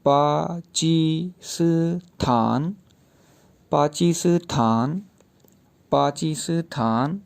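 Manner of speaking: पाचीस्थान drawn out very slowly, pa-chi-sthan with a rise; Chinese pronunciation of Pakistan. Shown as a demonstration. Teacher showing how Chinese say पाचीस्थान, very slow. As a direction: stretch पाचीस्थान syllable by syllable. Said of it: "ये pronounce इस तरह से होगा".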